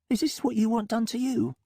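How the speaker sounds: doubtful, uneasy question; Molly voice. A narrator's reading. Molly challenges.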